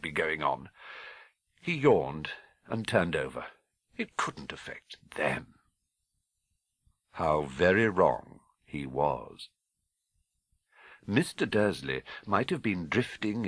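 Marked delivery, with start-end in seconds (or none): 4.0-5.5 soft whispered self-reassurance. Acted. Dursley reassuring himself, whispered. 7.1-9.5 slow, foreboding narration; ominous. A narrator's reading.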